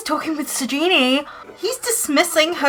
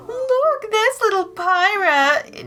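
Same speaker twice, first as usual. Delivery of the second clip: delighted coo; 9 semitones higher, slower than usual. Real, not acted. Spots the toddler Sim.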